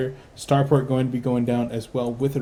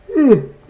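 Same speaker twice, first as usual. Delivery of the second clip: big tired yawn out loud; 11 dB louder than usual. Real, not acted. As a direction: yawn audibly, worn out. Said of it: "I'm yawning".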